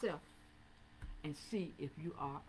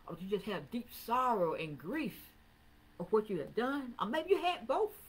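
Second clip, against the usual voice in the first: a preacher calling sinners to repent; full-force preaching; 11 dB louder than usual. Acted. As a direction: thunder the verses at the church. Preacher drives the point home.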